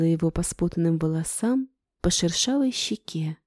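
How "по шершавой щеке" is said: usual speaking voice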